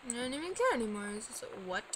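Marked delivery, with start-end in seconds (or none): none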